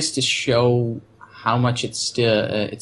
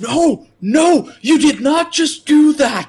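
much higher and louder, slow disbelieving cry; imitated anguish; 7 dB louder, slower than usual. John reacting to Grimes shot dead, anguished cry. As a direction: wail it in horror. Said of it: "And John's like".

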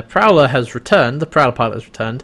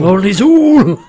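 hammed-up booming cry, higher and louder; mock proclamation; 7 semitones higher, 7 dB louder, slower than usual. Cohost riffing on the alien name, hammed-up shout. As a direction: proclaim it like a possessed god. Joking grand proclamation of Zool.

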